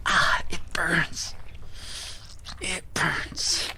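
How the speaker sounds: slow pained whisper. Acted. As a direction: breathe the words out through the sting. Wounds burning from the glue.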